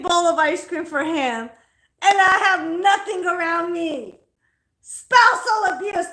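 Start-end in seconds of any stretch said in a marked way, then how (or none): 4.8-6.1 slow, loud fake indignation; mock outrage. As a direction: overplay the accusation.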